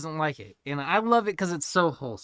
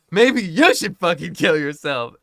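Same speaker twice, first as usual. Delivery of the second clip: blurted back; 10 dB louder than usual. Retold or imitated. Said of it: "So I go".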